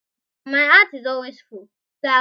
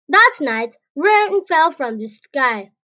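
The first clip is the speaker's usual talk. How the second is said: plain reading voice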